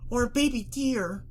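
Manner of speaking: high, drawn-out squeak. A narrator's reading. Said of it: "She let out a squeak".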